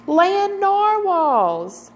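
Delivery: high, slow, delighted cry; excited exclamation. Acted. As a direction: sing it out with wonder. Unicorns spot Kelp.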